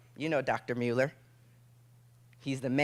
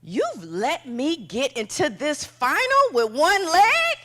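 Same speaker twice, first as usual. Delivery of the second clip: 1 taunting; 9 semitones higher, 5 dB louder, slower than usual. Retold or imitated. Taunting rivals in her head.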